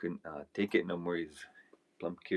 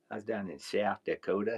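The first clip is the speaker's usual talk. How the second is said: imitated thick cowboy drawl, vowels stretched; punchline in a put-on southern drawl; 3 semitones higher, 4 dB louder than usual. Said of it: "heard his southern drawl".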